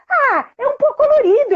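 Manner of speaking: put-on very high, plaintive child voice; a small girl stating the obvious; little girl. Daughter telling mother it's just coloured powder.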